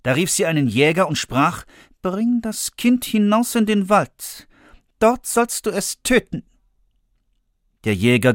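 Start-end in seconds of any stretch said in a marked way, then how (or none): 2.0-6.4 higher, cold royal command; commanding queen. Acted. Queen ordering the hunter, cold and commanding.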